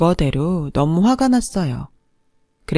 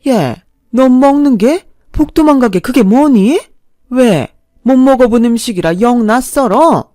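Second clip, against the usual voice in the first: high, loud, scornful jabs; snapping scornfully; 5 semitones higher, 7 dB louder than usual. A narrator's reading. Mother picks on girlfriend's eating, snapping scornfully. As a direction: lash out at her table manners. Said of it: "쏘아붙이듯 말을 하시더군요".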